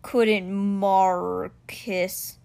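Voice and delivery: extremely slow, stretched big cat voice; stone cheetah, questioning. Acted. Stone cheetah asks after his creator, very slow voice. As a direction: draw each syllable right out.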